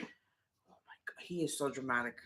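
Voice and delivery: hushed, breathy disbelief under the breath; whispered aside. Real, not acted. Wife whispering an aside about her dramatic husband, hushed. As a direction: whisper it so only the camera hears.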